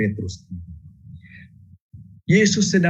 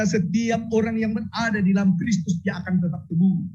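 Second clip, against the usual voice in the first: fervent preaching; 7 semitones higher than usual. Acted. Preaching at full force.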